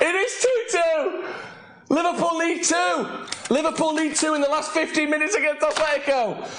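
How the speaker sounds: excited announcing. Real, not acted. Streamer telling viewers the score, excited yelling. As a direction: belt it out like a goal call.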